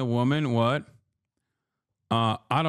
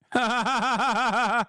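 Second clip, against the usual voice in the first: high laugh; 8 semitones higher than usual. Real, not acted. Laugh after mock voice.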